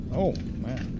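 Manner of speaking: normal talking voice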